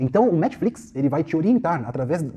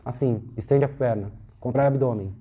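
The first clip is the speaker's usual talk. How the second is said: unmotivated seated teacher; 4 semitones lower than usual. Acted. Seated teacher giving a student cues, listless and unmotivated. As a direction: drone the exercise cues with no energy. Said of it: "qual é a motivação do aluno no professor sentado?".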